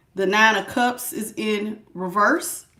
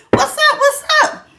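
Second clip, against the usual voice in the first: squeaky high, loud hype greeting; high playful what's up; hyped squeal; 8 dB louder than usual. Real, not acted. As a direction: sing out a big excited hello.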